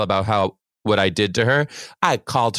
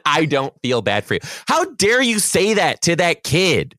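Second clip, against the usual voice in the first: raised pitch, rising into outrage; indignant scolding; 7 semitones higher, 4 dB louder than usual. Retold or imitated. Teacher scolding the crying student for meanness, indignant. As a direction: repeat it as a heated reproach.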